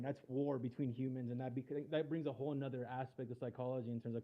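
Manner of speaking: muffled, quiet mic sound; muffled like a hand over the mouth. Real, not acted. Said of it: "like, uh, muffled a little bit".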